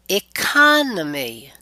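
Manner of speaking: slow "economy" with CON stressed and stretched; stress before suffix. Shown as a demonstration. Teacher shows the rule, "economy" stressed on CON. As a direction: hit CON in "economy" and draw it out. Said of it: "again, stress right before it".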